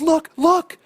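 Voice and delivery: high, loud eager call; excited shouting. Retold or imitated. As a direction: burst in to show it off. Wife bursts in with the phone.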